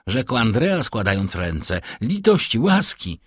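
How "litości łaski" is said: high-pitched begging for mercy; acted plea, pitched high; pleading. A narrator's reading. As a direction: beg urgently in a much higher voice. Fugitive begs women for mercy.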